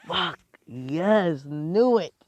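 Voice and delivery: loud, high cheer; exultant, drawn-up whoop; triumphant shout. Acted. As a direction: yell it like you just won the bet. Acted triumphant reaction of boys.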